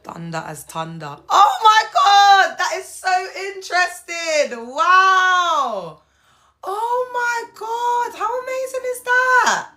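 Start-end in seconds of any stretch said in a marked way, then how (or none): 1.3-9.7 very high, drawn-out amazement; loud, soaring, stretched-out awe; astonished. Real, not acted. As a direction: squeal it slowly in sheer wonder. Amazed at viewer's history fact.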